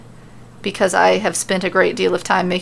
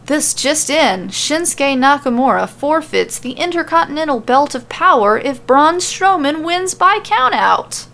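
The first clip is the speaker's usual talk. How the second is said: Michael Cole announcing; 7 semitones higher than usual. Acted. Michael Cole breaks the news to everyone, lightly announcer-voiced. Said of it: "It is the voice of Michael Cole".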